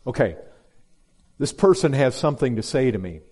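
ordinary talk

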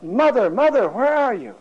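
high, loud cry across the church; retold desperate call to mother. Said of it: "he cried out".

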